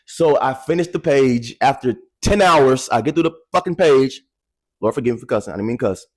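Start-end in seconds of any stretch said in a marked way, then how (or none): none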